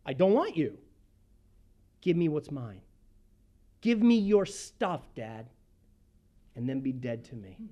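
defiant son cutting off his dad; blunt demand. Retold or imitated. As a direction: spell out the rejection flatly. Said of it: "He tells his father flat out".

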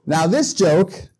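ordinary talk

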